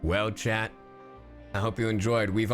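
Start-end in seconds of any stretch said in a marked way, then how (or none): none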